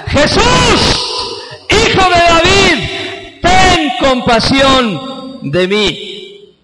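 high loud cry, slowing at the end; blind man's shout. Acted. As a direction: beg loudly for mercy. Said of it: "gritó el ciego". (el ciego grita pidiendo compasión)

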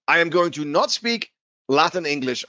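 ordinary talk, nothing marked

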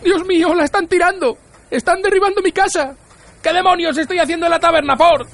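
very high-pitched, frantic outcry; panicked yelling. A narrator's reading. Arthur at the window seeing his house demolished, panicked yelling. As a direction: shriek in horror, pitch held right up.

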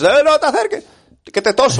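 high, loud jokey threat with a laugh; mock warning shout. Friend suggesting a shout at a queue jumper, laughing.

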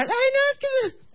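very high falsetto mock panic squeal; panicked girlfriend. Girlfriend screaming as zombies pop out, mock panicked shriek. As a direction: squeal helplessly in a put-on female voice. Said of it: "mi novia gritando, asustada, con pánico".

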